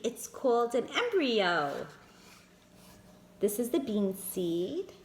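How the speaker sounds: ordinary delivery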